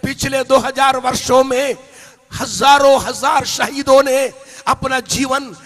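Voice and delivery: rising to loud, high shouting, slowing at the peaks; preaching at full force. Acted. Preacher proclaims hope in Jesus, building to shouts. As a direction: build until you are crying out to the crowd.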